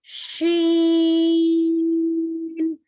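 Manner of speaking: शीन held long; long sheen. Shown as a demonstration. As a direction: stretch शीन slowly. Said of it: "इस चीज की sound आएगी".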